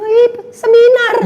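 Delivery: high, slow amused mock gasp; mocking her own younger self with a laugh; laughing inner thought. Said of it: "Dalam hati gua". (ucapan dalam hati sambil tertawa geli)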